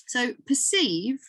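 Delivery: usual speaking voice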